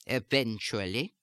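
German-accented "eventually", clipped syllables with secondary stress, rising end. Shown as a demonstration. Accent coach repeating the stress pattern, "eventually" in German accent. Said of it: "unstressed syllables ... will take on a secondary stress".

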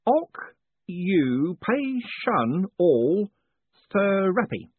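"oc you pay shun all" syllable by syllable, very slow; struggling over "oc you pay shun all therapy"; careful sounding out. A narrator's reading. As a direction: spell the long word out slowly. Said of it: "his forehead wrinkled".

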